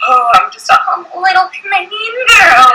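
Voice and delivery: high, sugary mock modesty; fake-sweet humble girl voice. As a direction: act falsely humble as a joke. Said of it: "I was like".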